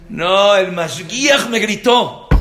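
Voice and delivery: boy whining about the teacher, mock-tearful; whiny crying boy. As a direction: wail it like a hurt kid. Crying boy voiced in story.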